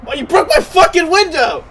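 loud, high outburst; indignant yell. Real, not acted. Player protests a broken car window, indignant yell. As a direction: yell at whoever just wrecked it.

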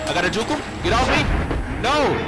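frantic loud protests; yelling no while grabbed; panicking gamer. Real, not acted. Being caught, yelling.

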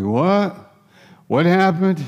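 high, rising startled question; waking up startled. Retold or imitated. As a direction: act out someone suddenly jolted awake. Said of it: "I think of a person waking up like".

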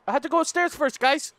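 usual speaking voice

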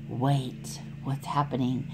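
hushed, breathy suspense whisper. A narrator's reading. Suspense moment in story.

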